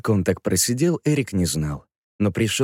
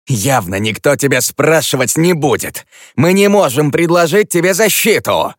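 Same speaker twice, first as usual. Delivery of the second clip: raised, sharp and annoyed; irritated shout; 8 semitones higher, 7 dB louder than usual. Acted. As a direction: snap it out in irritation. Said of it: "Голос Флэша звучал раздражённо".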